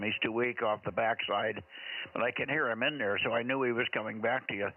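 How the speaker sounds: ordinary delivery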